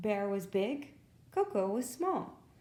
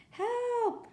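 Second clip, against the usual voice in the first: cry for help. A narrator's reading. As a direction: call out for rescue again. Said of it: "came the cry again".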